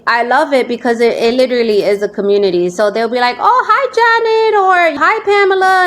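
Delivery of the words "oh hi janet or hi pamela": high, bright-pitched chat hello; cheery greeting. Retold or imitated. Host imitating viewers greeting each other, high and cheery. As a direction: sing out a friendly hi.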